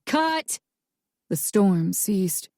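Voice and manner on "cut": mock shout. A narrator's reading.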